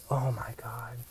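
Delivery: exasperated under-breath whisper. Real, not acted. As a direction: breathe it out in disbelief. Exasperated at Snapchat users.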